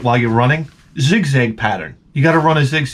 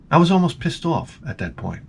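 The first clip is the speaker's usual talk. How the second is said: ordinary talk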